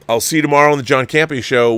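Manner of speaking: ordinary talk, nothing marked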